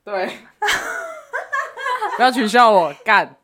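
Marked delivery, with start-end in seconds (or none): 0.6-2.1 high squealing laugh. Real, not acted. Laughs after being corrected.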